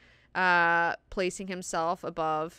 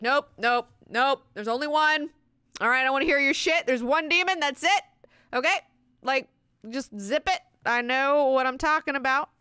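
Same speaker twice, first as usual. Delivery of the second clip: impatient priest; 9 semitones higher, 6 dB louder than usual. Retold or imitated. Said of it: "And he's like".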